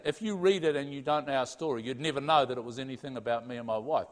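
usual speaking voice

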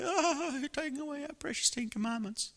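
a high, whiny mock protest from the complainers; mock complaint. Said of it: "there's a hue and cry".

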